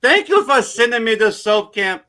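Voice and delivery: high-pitched, hammed-up Irish accent; Irish brogue. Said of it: "your best Irish brogue".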